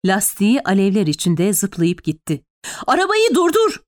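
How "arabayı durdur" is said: high-pitched urgent yell to the driver; shouted command without much extra volume; Fevzi crying out. A narrator's reading. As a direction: call out an order, pitch jumped right up. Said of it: "diye haykırdı Fevzi".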